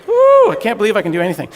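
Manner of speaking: loud, fast, high cheer; excited whoop. Retold or imitated. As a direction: whoop like a kid set free. Intel guy's delight retold.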